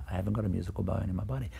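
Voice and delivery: fast, rhythmic repeat of the sentence; rhythm of speech. Shown as a demonstration. Teacher showing the rhythm in the pupil's sentence, fast and even. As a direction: bring out the beat of I haven't got a musical bone in my body. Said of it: "look at the rhythm".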